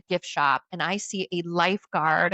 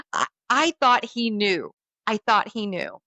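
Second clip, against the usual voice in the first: ordinary talk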